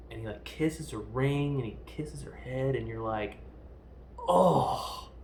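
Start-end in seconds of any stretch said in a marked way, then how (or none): 4.2-5.1 loud, high acted groan; anguished groan. Viewer watching the kiss goodbye, loud anguished groan. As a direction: heartbroken "oh", played up loudly.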